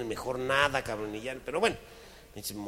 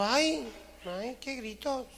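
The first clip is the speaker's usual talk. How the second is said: high voice; 13 semitones higher, much slower than usual. Acted. Mujer remeda asustada.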